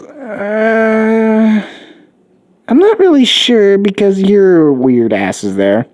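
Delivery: a lightly voiced teenage girl, unsure and slow; wary teen girl.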